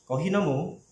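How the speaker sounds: soft "nomu" lifted at the end; rising question intonation. Shown as a demonstration. Teacher repeating the correct model for students, rising on "nomu". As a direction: tip "nomu" upward as a question. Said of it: "kayak gitu ya nadanya".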